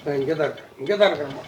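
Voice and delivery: low and very soft; quiet reassurance. Acted. Uncle assuring child he is staying, hushed and low. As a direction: promise it quietly.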